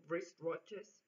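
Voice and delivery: normal talking voice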